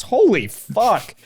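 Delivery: high, drawn-out mock disbelief; retold exasperation, dragged out slowly; appalled. Passenger telling driver to relax, mock appalled.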